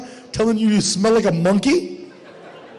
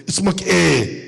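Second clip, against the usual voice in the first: put-on Arabic accent; 3 semitones lower, slower than usual. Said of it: "That's what they say".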